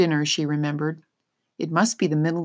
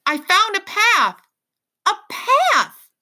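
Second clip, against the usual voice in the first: high, loud excited yell; 6 dB louder, slower than usual. Acted. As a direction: call it out toward the boat. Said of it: "she yelled again".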